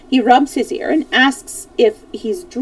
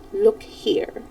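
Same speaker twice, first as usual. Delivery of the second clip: hushed, almost breathless; quiet solemn look here; calm and solemn; 2 semitones lower, 7 dB quieter than usual. A narrator's reading. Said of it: "She suddenly calmed herself".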